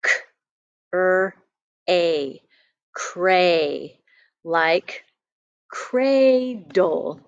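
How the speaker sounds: k, er, a said as separate sounds, then blended to cray; sounding out. Shown as a demonstration. Teacher sounds out an open syllable for children, segmenting. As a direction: pull the sounds apart, then join them into cray. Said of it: "let's read this syllable".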